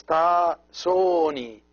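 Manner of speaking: "soni" with a long, held O; teacher's careful long-O "soni". Shown as a demonstration. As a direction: draw out the O in "soni". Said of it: "long O".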